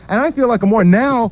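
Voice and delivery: normal talking voice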